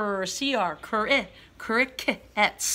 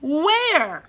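loud question; 6 semitones higher, 5 dB louder than usual. Shown as a demonstration. Reading question word expressively.

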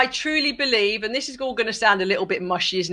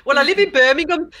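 a playful, higher, louder put-on Birmingham accent; 6 semitones higher, 5 dB louder than usual. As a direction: joke it out in a thick Brummie accent. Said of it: "That's a Birmingham accent".

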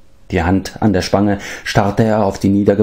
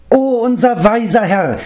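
much higher, eager praise for his master; excited higher creature voice; enthusiastic cry. A narrator's reading. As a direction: gush with delight. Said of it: "rief Gogi begeistert".

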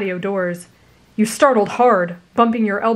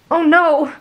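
high, loud sudden alarm; realizing the mistake; 8 semitones higher, 7 dB louder than usual. A narrator's reading.

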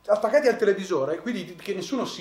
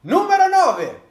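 announcer; 11 semitones higher, 9 dB louder, slower than usual. Acted. Annuncia la classifica.

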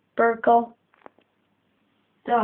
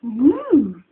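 ordinary delivery

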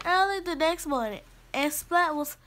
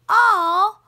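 loud, higher-pitched "oh"; dismayed outcry; 5 semitones higher, 9 dB louder than usual. Acted. Class told there is no milk today, crying out in dismay. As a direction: groan out loud like a let-down class.

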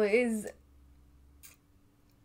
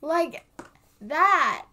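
loud, excited outburst; excited shout; 7 semitones higher, 8 dB louder than usual. Real, not acted. Reacting to the singers, shouting with excitement. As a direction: yell it out in delight. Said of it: "I am shouting".

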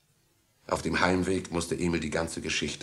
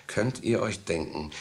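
ordinary talk, nothing marked

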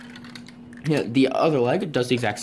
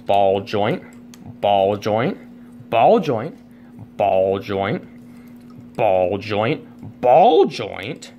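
playful escalating list; 4 dB louder, much slower than usual. Real, not acted. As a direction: build it up like a chant getting louder. Excitedly rattling off joint names.

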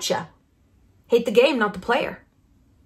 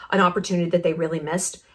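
ordinary delivery

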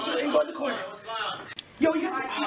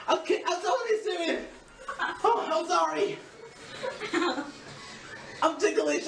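ordinary delivery

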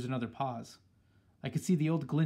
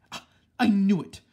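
grandmother shouts louder and higher in sudden triumph; excited shout; 4 semitones higher, 9 dB louder than usual. A narrator's reading. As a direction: leap up shouting that you knew it. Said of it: "leapt out of her chair shouting".

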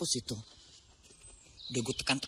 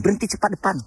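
a louder mock telling-off, demanding a stop; retold angry order; 4 semitones higher, 6 dB louder, faster than usual. Mother imitating how she'd scold the bus driver, mock angry. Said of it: "Ku maki langsung".

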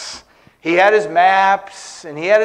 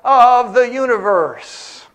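slow, raised mock-grand declaration; hammed-up me-centric boast; self-centered proclamation; 4 semitones higher, slower than usual. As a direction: proclaim it slowly, full of self-importance. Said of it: "live in a way that says".

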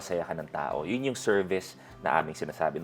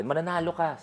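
higher mock voice of an old father encouraging; a hint of a hopeful old man, pitched up; imitating the old man; 9 semitones higher than usual. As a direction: lightly voice the old man promising victory. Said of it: "Sabi ni tatay sa akin".

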